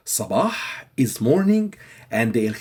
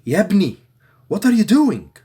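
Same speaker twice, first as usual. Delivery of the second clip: higher, louder, lively Yabni, what are you doing; lively call to a friend; 8 semitones higher, 5 dB louder than usual. Retold or imitated. Egyptian calling to a friend, higher and louder. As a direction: call out to a buddy. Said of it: "They say".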